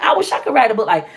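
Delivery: ordinary talk, nothing marked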